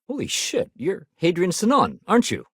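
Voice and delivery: high-pitched amazed recognition; starstruck surprise. Acted. Blurting out on recognising Hadrian Sinon, starstruck. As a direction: gasp it out, thrilled to recognise them.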